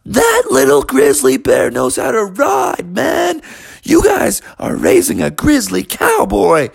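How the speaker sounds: a fan shouting to the parents; hyped crowd guy. Acted. Crowd cheers bear.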